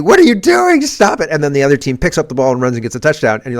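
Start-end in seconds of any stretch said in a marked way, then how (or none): 0.0-1.3 high, loud yell at a fumbling player; mock screaming fans. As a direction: shout it up high like a furious stadium crowd. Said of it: "everybody's screaming at you".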